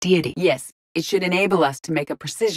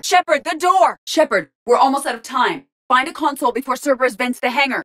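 raised, higher-pitched callout; urgent urging; 6 semitones higher, 3 dB louder than usual. Acted. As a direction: press the commander against the clock. Urging commander as time runs out.

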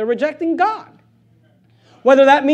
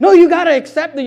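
higher, louder, indignant insisting; mock angry outburst; mad soul winner; 6 semitones higher, 8 dB louder than usual. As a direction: imitate someone offended and demanding. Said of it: "they get mad".

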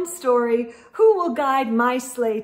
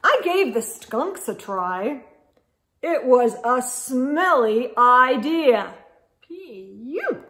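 put-on lively read-aloud for kids; animated storybook reading; story narration; 4 dB louder, slower than usual. A narrator's reading. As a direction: read it like a picture book.

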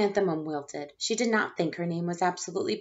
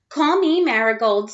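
much louder, eager claim; 4 semitones higher, 9 dB louder than usual. Acted. Jo eagerly claiming a flower name, loud eager. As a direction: show off your new name.

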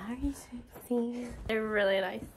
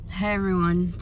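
ordinary talk

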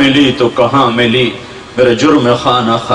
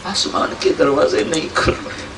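hushed whisper; 7 dB quieter than usual. Acted. Preacher repeats line, first hushed.

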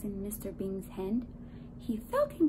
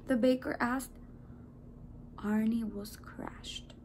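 ordinary delivery, nothing marked

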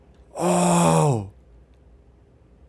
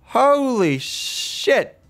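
very slow, high, stretched-out disbelief; stunned; 11 semitones higher, much slower than usual. Real, not acted. Player stunned by the game scene, slow high holy shit. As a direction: drag it out in awe.

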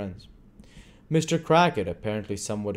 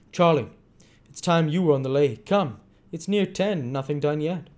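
higher-pitched, louder urging; wheedling gang master; 8 semitones higher, 5 dB louder than usual. A narrator's reading. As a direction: chivvy the lads out to work.

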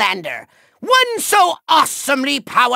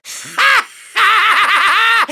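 long, high villain cackle; evil cackle; 4 semitones higher, much slower than usual. Acted. Villain gloats over a trick up his sleeve, maniacal laugh. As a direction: cackle triumphantly, drawn right out.

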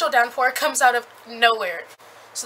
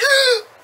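sharp high delighted gasp; excited gasp; 10 dB louder than usual. Real, not acted. Reader gasps as the swimming scene begins, a sharp delighted gasp. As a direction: gasp like you just spotted your favourite part.

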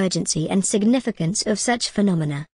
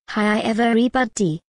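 Doctor Nick; 2 semitones higher than usual. Acted. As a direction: stretch the greeting like a cartoon doctor. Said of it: "Let me try that again".

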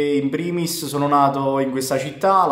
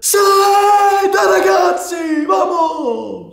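high, slow, loud shouted cheer; goal celebration shout; 6 dB louder, much slower than usual. Shown as a demonstration. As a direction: yell it like your team just scored. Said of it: "Come esulti ad un gol?".

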